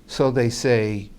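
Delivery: ordinary talk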